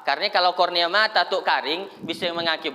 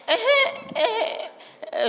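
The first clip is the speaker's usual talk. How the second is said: put-on high fussing kid voice; child fussing. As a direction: whimper like a toddler.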